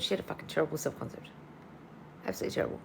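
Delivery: muttered aside. Real, not acted. Gossiping about Princess Diana.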